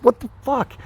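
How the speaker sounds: high-pitched startled protest; retold shock. Retold shock at dog's attack.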